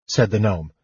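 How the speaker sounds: plain reading voice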